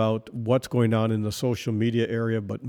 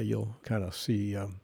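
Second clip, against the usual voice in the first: ordinary talk, nothing marked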